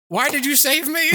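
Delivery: a comic cry of betrayal; mock despair. Despairing at huge hospital bill.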